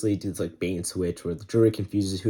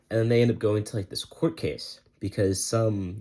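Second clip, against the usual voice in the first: ordinary talk, nothing marked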